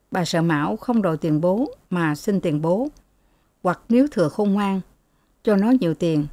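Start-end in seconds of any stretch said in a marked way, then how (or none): none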